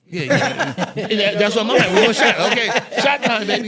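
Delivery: high, loud laughing retelling; laughing hard. Retold or imitated. Telling friends about the first shot, laughing loudly. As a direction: laugh through the story of the shot.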